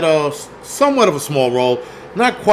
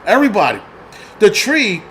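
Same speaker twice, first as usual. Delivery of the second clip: ordinary delivery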